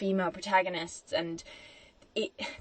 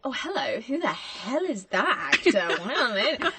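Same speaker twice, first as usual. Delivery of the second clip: ogle an attractive actor, hammed-up; mock-flirty double take; playfully smitten; 3 semitones higher than usual. Acting out adult crush on actor.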